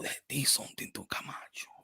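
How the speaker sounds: hushed secret whisper. Acted. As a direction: let them in on it in a whisper. Secret confession to listener.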